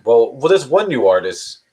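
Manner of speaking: ordinary talk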